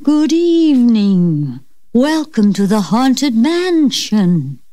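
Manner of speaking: slow, hollow ghost host greeting; slow ghostly welcome from the unseen host. Acted. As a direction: welcome them like a ghost from beyond. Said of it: "greeted by a ghostly voice".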